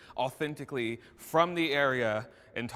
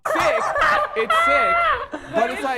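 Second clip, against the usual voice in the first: much higher and louder, cracking up; laughing shout; 14 dB louder, slower than usual. Real, not acted. Guest cracking up at the panel's reaction, laughing loudly. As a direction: shriek it through real laughter.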